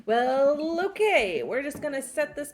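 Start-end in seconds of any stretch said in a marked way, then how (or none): none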